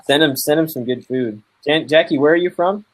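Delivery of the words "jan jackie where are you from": ordinary talk, nothing marked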